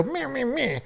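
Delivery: high, soft mocking meh-meh; mock whiny meh. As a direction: mimic someone grumbling off childishly. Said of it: "they would leave and go".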